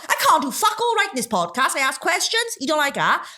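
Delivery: high, aggrieved complaint; exasperated. Real, not acted. Podcaster told off for asking questions, exasperated. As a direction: throw up a high-pitched, indignant moan. Heated argument over a theory.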